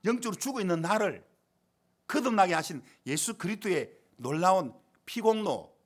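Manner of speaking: fervent pulpit exclamation; impassioned preaching. Real, not acted. Preacher marvelling at being saved by the Lord, impassioned preaching. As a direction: proclaim it in emphatic, swelling phrases.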